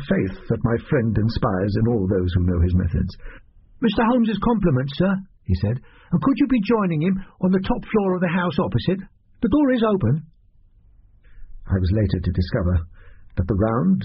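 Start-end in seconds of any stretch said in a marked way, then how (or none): 3.8-5.1 put-on high-pitched young servant voice; boy messenger. A narrator's reading. Billy passing on Holmes's compliments, boy messenger voice. 6.1-10.2 put-on high-pitched young servant voice; boy messenger. A narrator's reading. Billy passing on Holmes's compliments, boy messenger voice.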